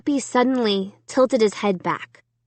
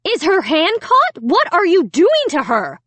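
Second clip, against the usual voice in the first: screaming mother; 8 semitones higher, 4 dB louder than usual. A narrator's reading. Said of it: "Alicia's mother was screaming".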